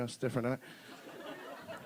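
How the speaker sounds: usual speaking voice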